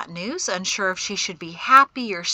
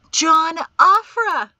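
slow, loud, high-pitched cry; delighted exclamation; 10 semitones higher, 12 dB louder, slower than usual. A narrator's reading. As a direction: call out the name in delight. Delighted woman cries out the name.

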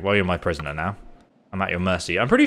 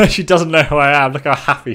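laughing; 5 semitones higher, 7 dB louder than usual. Real, not acted. Laughing at unaware enemy leader.

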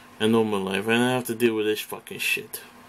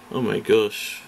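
ordinary talk, nothing marked

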